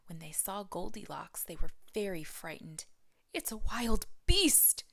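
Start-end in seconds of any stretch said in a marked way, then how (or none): 3.3-4.8 mild mock growl, slow and loud; slow it and push it out; Papa Bear growling. A narrator's reading. Papa Bear fears Goldilocks, mild growl. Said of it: "Papa Bear growled".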